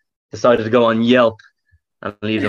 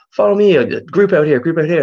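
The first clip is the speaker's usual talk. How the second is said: mock bouncer beckoning; 8 semitones higher, 6 dB louder than usual. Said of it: "He's like".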